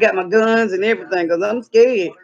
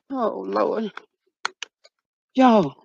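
normal talking voice

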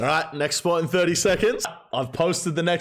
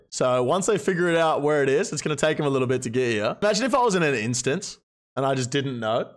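ordinary talk

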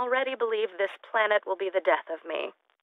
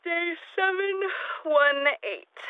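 strange drawn-out announcement; 9 semitones higher, much slower than usual. Acted. Unhinged log announcement.

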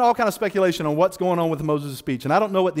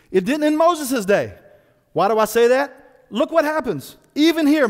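ordinary talk, nothing marked

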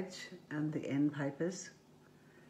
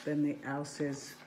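plain reading voice

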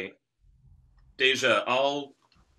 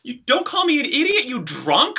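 angry retort; 13 semitones higher than usual. Real, not acted. Roommates' quarrel erupts.

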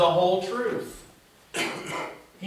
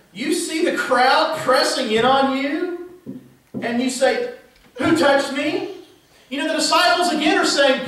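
high, incredulous disciples scolding Jesus; disbelieving friends who think he's missed it; exasperated disciples; 8 semitones higher than usual. Acted.

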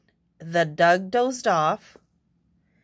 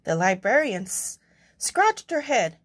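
plain reading voice

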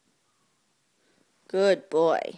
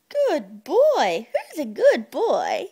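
high sing-song pet voice; squeaky praising a dog; 7 semitones higher than usual. Real, not acted. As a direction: coo at the dog, pitched way up.

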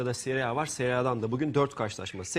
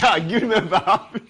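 laughing; 6 semitones higher, 9 dB louder than usual. Real, not acted. Presenter cracks up on air.

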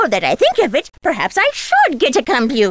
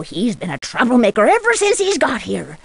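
ordinary talk

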